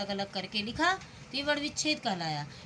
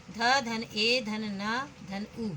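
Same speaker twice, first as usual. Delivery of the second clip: slow spelling out; much slower than usual. Shown as a demonstration. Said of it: "उ आ रहा है अंत में".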